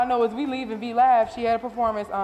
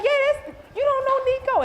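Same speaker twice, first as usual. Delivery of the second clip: teasing excitement. Retold or imitated. Assistant teasing her boss for not knowing Nico. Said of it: "She was like".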